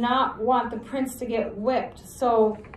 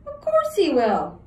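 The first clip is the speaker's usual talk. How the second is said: insistent; 7 semitones higher, 5 dB louder than usual. Acted. Jemmy insists.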